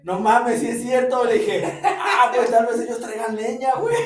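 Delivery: loud laughing quote. Retold or imitated. Quoting himself about the other campers, laughing loudly. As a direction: laugh through the words.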